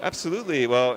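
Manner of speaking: normal talking voice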